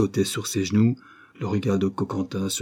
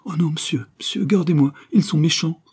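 put-on high, rising pleas of a little boy; small boy begging the detective not to send him away, high and pleading; pleading child; 8 semitones higher, 4 dB louder than usual. A narrator's reading. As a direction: beg the detective to keep you. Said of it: "en suppliant".

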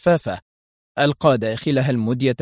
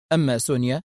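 plain reading voice

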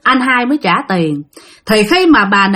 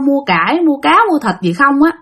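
plain reading voice

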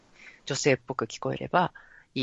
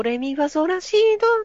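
much higher and louder, rising feminine voice; female voice; 14 semitones higher, 6 dB louder than usual. Shown as a demonstration. Showing the female voice by climbing the scale. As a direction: slide up into a bright female register. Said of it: "女声がこれになります".

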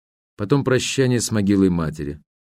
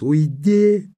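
high, loud cry; shout; 7 semitones higher, 4 dB louder than usual. A narrator's reading. Ruth screams at Herbert to leave. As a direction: shout him away, tears breaking out. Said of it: "закричала она".

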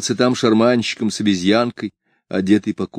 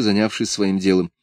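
ordinary delivery

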